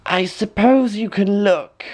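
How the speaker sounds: put-on high crafty old crone; high wheedling crone voice; old woman. A narrator's reading. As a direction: coax her like a sly old hag. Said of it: "said the old woman".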